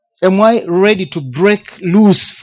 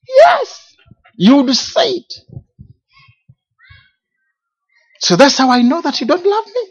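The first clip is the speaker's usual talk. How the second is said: higher, tearful insistence; wife's plea; 12 semitones higher than usual. Retold or imitated. Wife pleading with her husband to say it, voiced high and tearful. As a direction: sound like a wife aching to hear it. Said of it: "She says".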